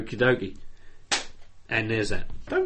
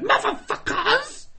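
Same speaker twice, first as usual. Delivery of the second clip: higher, slow, crying and laughing rant; screeching Queen rant; much slower than usual. Retold or imitated. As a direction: drawl it out high, then sink to a whisper. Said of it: "doing Last Podcast Standing and going".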